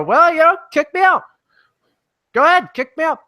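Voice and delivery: a cheeky high-voiced go-ahead-and-try-it; defiant taunt. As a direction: dare her to do it, high and cocky. Taunting the woman who threatened him.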